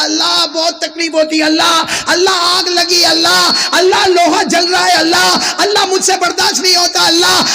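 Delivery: high, anguished wailing for God; a mock-anguished plea to Allah; sinner crying out in pain. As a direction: cry out as if the iron burns. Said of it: "اتنا زور سے چلائے گا".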